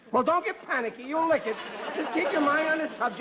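high, flustered reassurance; panicky encouragement. Acted. Father hovering over son's homework, panicky. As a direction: urge calm while getting worked up yourself.

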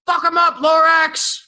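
very high, loud, slow yell from the stands; shouted cheer. Retold or imitated. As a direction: cheer him on from the crowd. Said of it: "He looks at me and goes".

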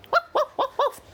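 very high little woofs; dog barking. Acted. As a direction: woof like a puppy.

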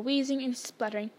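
ordinary delivery, nothing marked

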